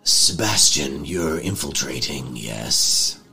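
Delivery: low, slow, deliberate character voice; Johnny's voice. Acted. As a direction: drawl it out as Johnny. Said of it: "Johnny speaks next and goes".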